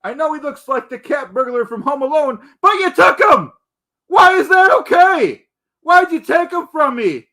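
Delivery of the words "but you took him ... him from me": higher and louder, yelling the grievance; outraged yell at the rivals who took his buddy; angry shout. Acted. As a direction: shout it like he's been robbed. Ranting at rivals over stolen friends.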